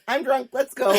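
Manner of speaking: laughing through a tipsy quote. Retold or imitated. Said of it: "She said".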